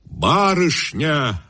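high, very slow call; sudden shout. A narrator's reading. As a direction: cry out on spotting her, drawn out. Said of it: "вдруг крикнул староста". (староста вдруг кричит, высоко и протяжно)

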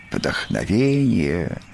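slow, musing single word; lost-in-thought poet; pensive. A narrator's reading. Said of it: "задумчиво произнёс Бараш".

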